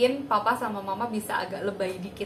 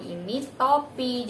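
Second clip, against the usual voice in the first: slow clear naming; 3 semitones higher, slower than usual. Shown as a demonstration. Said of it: "beri pemahaman dulu".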